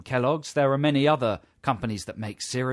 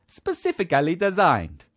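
much higher, louder Italian chef voice; silly Italian accent; 5 semitones higher, 4 dB louder than usual. Retold or imitated. Host mimicking the chef's advert line, Italian accent. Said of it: "He says".

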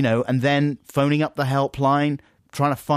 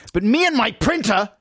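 loud, high indignant outburst; wounded, shouted complaint; getting angry; 11 semitones higher, 5 dB louder than usual. Real, not acted. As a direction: protest in outraged exasperation. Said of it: "you're getting angry".

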